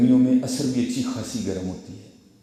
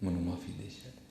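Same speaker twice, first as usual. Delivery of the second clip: soft, choked-up apology; hushed, half-crying plea for forgiveness; barely voiced, weepy sorry; retold tearful plea; 10 dB quieter than usual. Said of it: "رو کر کہنے لگا".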